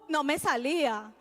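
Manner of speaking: ordinary talk, nothing marked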